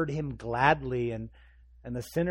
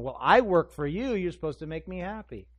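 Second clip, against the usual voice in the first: loud, raised protest; entitled demand; 4 semitones higher, 7 dB louder than usual. Acted. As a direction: insist on what is owed to you. Voicing entitled brother's demand.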